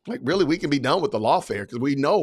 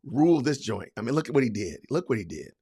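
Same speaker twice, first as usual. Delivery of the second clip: sarcastic mock fan; 5 semitones lower, 3 dB quieter than usual.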